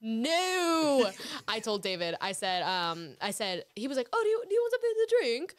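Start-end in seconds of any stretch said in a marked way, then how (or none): 0.0-1.1 high, loud, drawn-out refusal; protesting no. Pretend. Refusing the offer of a drink. 4.1-5.5 high, loud, hurried mock British; bad British accent. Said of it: "Because now you're British".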